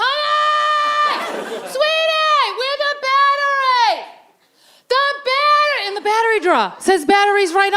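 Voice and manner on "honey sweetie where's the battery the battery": high, shouting from another room; husband yelling. Retold or imitated. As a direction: call out for your wife. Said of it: "scream my name for five minutes".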